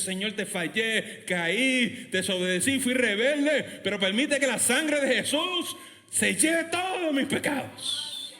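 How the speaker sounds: confession building to a high cry; slow, drawn-out cry for forgiveness; impassioned prayer. Acted. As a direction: plead to God, rising into a shout. Models a confession prayer to God.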